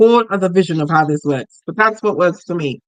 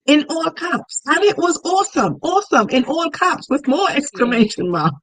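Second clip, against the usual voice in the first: excited; 6 semitones higher than usual. Shown as a demonstration. Hyping writing in all caps.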